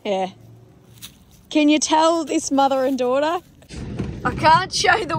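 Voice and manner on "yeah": ordinary talk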